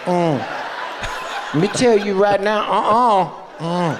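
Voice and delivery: light mock Southern twang with a laugh; a hint of a Southern preacher aunt; Southern twang imitation. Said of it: "very Southern twang".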